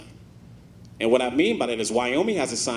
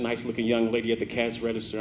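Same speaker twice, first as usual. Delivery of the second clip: ordinary talk, nothing marked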